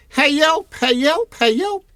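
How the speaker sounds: very high, loud repeated yelp; roaring for help. Acted. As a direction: yelp out for help like a captured boy. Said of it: "Poe's roaring".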